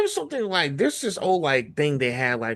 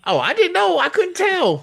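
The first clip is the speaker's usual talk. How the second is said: much higher, playful fake shock; high teasing oh-really; mock surprise; 5 dB louder than usual. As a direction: pretend to be amazed to tease her. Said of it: "teasing reply to partner".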